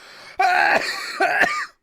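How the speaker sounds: squealing burst of laughter; high squealing laugh; high shrieking laugh. Real, not acted. Big laugh at Bat cow.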